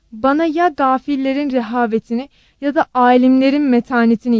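loud, emphatic plea to God; earnest prayer. A narrator's reading. Aunt praying to God for help, pleading and emphatic. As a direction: implore firmly, phrase by phrase.

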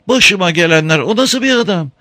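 high dismayed woman's voice; wife's voice. A narrator's reading. Wife's voice in dialogue.

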